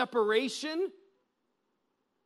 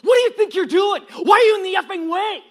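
put-on furious yelled cursing at a child; reenacted furious shout; angry shout; 11 semitones higher, 16 dB louder, faster than usual. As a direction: yell it like an enraged stranger. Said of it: "he started to swear at my four-year-old girl".

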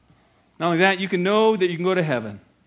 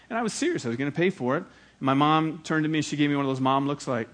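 usual speaking voice

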